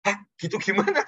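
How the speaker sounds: high, startled retort in retelling. Retold or imitated. Preacher recalling his reaction to the old man's cry, startled. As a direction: react as if jolted by a shout. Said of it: "Saya sampai kaget ya".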